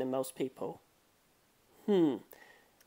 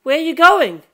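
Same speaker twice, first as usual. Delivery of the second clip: calling out; 10 semitones higher, 11 dB louder than usual. Acted. So I asked him.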